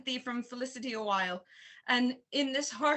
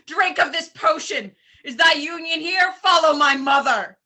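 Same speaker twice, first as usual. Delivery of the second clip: forcing the poison, enraged; furious shout; 4 semitones higher, 11 dB louder than usual. Acted. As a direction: shout it, raging and loud. Hamlet forces poison on king.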